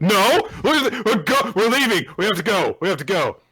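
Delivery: high, loud embarrassed yelling; flustered shout. Retold or imitated. Damien flustered retreat.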